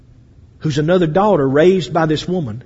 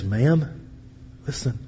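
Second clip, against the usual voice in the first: hushed plea; 6 semitones lower, 9 dB quieter than usual. Acted. Miriam to Pharaoh's daughter.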